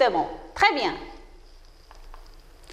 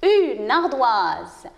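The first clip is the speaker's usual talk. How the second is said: "une ardoise" slow and lower; slow model reading; 4 semitones lower, slower than usual. Shown as a demonstration.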